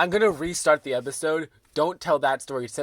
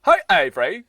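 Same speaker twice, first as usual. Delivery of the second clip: loud hammed-up British greeting; put-on British voice; 3 semitones higher, 8 dB louder than usual. Host redoing the intro to co-host, mock British accent. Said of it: "Why are you doing that voice?".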